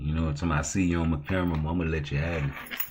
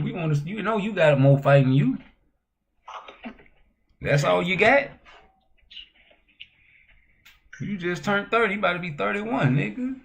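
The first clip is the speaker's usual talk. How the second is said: heated rant; 13 semitones higher, 4 dB louder than usual. Real, not acted. Ranting at Tank over his fights.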